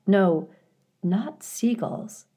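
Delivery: usual speaking voice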